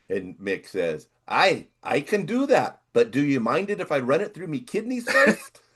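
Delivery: lightly put-on Irish voice for the punchline; Irish joke accent. Telling the Saint Patrick's Day joke, a mock Irish accent. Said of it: "I don't know what that accent is".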